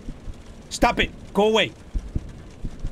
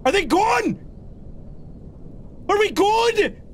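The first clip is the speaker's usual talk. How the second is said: high-pitched anxious question; frightened; 10 semitones higher than usual. Real, not acted. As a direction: ask in a high, panicky squeak. Frightened check after a scare.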